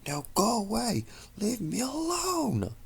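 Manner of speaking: high desperate screams; anguished shriek to make the voice go away; screaming. Acted. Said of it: "Luna screamed".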